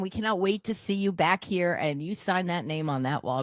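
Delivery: normal talking voice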